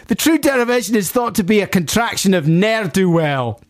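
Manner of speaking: high put-on Glaswegian; Glaswegian accent. Host resuming the listener's message after apologising, Glaswegian accent. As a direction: slip back into the broad Glasgow accent. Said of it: "stop doing the accent now. No, I'm not".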